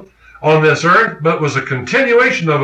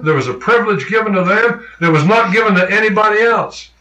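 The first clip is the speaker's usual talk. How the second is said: raised, ringing preacher's voice, pressing the point; fervent preaching; 5 semitones higher than usual. Acted. Preacher urging his congregation to claim the promise, fervent and raised. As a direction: drive it home to the congregation.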